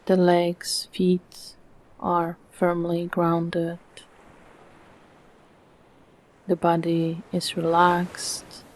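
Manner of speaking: calm meditation voice with long, unhurried pauses; soothing, evenly paced relaxation guidance; guided meditation. Acted. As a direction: guide the listener gently into stillness, phrase by phrase.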